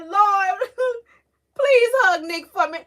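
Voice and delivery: high, loud crying plea with a laugh; tearful prayer. Retold or imitated. Mother retelling her prayer for her son, tearful. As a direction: sob it out to the Lord. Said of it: "I was like".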